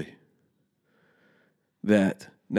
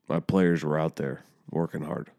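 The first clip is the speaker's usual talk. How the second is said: normal talking voice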